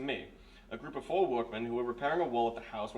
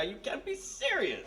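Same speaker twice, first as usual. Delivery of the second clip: incredulous scoff; 11 semitones higher, slower than usual. Acted. Major scoffs at hypnosis idea.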